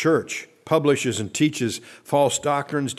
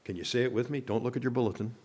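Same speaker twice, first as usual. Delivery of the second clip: ordinary talk, nothing marked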